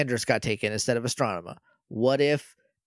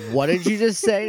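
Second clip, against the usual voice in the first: mock outrage; 6 semitones higher, 4 dB louder than usual. Sky outraged at villain.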